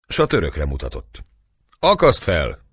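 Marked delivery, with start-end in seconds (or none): 1.8-2.6 curt order. A narrator's reading. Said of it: "válaszolta Dobó".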